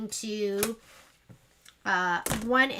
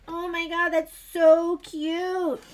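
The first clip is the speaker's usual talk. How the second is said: high squeal of delight, drawn out; loud, stretched-out fangirl coo; retold gushing; 6 semitones higher, 7 dB louder, much slower than usual. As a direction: gush over something adorable. Gushing retold reaction to a quilt.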